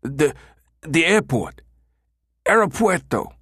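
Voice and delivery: high, terrified stammer. A narrator's reading. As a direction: stumble over the words in fear. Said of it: "Two-Tone stammered".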